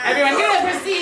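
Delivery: fast, high, loud sing-song call for applause; hype call. Real, not acted. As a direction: pump up the crowd. Host calls out applause.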